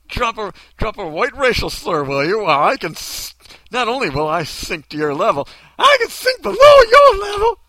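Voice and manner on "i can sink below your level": loud, very high cartoon maid; hammed-up hollering old-lady voice; put-on maid voice. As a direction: wail it up high in mock outrage. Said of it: "you're gonna sound like this".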